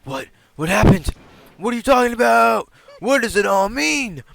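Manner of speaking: loud, high frantic questions; panicked. Acted. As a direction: demand answers in panic. Panicked demands.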